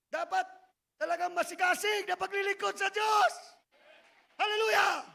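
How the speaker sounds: loud, high exhortation; rally the congregation to zeal; fiery preaching. Real, not acted. Preacher urging the church to serve, loud and fervent.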